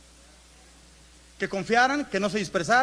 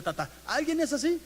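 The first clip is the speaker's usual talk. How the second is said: usual speaking voice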